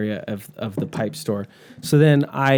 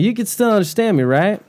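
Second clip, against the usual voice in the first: lightly put-on Southern accent, pitched up; 8 semitones higher than usual. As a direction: teach in a higher Southern accent. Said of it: "lessons in a Southern accent".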